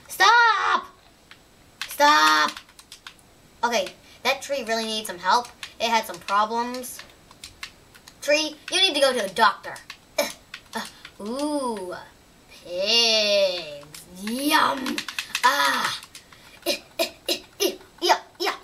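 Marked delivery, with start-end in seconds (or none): 0.1-2.5 high, loud shout. Real, not acted. Shouting at the game. 16.7-17.7 high, bubbly giggling; giggle. Real, not acted. Laughing at pigs.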